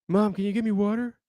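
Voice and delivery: put-on high-pitched kid calling to mom; high mock child voice; imitated son. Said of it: "my son will say something".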